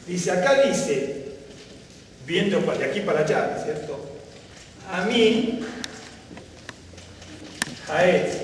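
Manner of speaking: ordinary delivery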